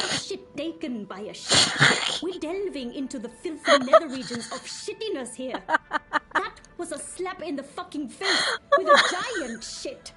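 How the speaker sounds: angry rant. Acted. Boss rant building.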